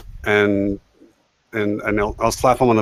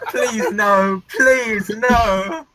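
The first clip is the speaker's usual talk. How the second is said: high, drawn-out mock begging; mock pleading; 10 semitones higher, much slower than usual. Player joking about being punched, mock pleading. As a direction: plead in a squeaky put-on high voice.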